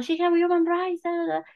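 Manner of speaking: imitating the husband yelling his protest; retold shouting. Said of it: "Y mi esposo gritando". (imitando al esposo gritando, más agudo y fuerte)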